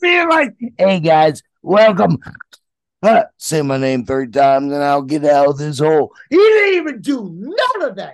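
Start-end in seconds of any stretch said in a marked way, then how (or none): 0.8-6.1 low, loud ghoulish showman; loud, low-pitched character patter; Beetlejuice. Acted. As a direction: imitate a rowdy ghost in a low, punchy voice. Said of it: "he wasn't even being Beetlejuice".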